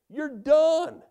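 sudden high, loud "done" burst; mocking verdict. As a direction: slam the door on him with "done". Friends pronounce Haman finished.

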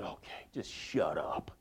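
whispered, slow, defeated give-in. Acted. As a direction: breathe it out like he's beaten. Worn-down husband gives in.

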